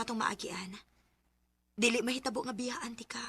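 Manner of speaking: soft breathy whisper of love; tender whisper. Acted. Wife reassuring her worried husband, whispered tenderly. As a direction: hush it out lovingly, barely voiced.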